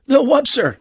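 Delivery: high, loud astonished reply; startled crewman. Acted. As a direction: report it in amazement. Crewman startled by talking animal.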